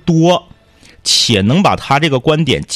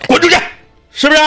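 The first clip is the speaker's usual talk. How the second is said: angry; 3 dB louder, much faster than usual. Retold or imitated. Grandpa scolding his son.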